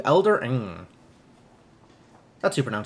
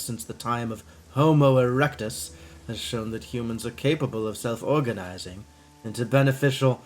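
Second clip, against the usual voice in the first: put-on Dracula-like accent; 3 semitones lower than usual. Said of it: "kinda Dracula to me".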